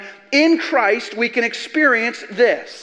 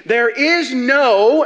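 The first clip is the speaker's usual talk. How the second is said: slow, louder build that lands on "no"; stress on no; 3 semitones higher, 5 dB louder, much slower than usual. Shown as a demonstration. Preacher hammering the key word to his church, slow and loud. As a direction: draw out "there is no" and hang on "no". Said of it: "no being the strongest emphasized word".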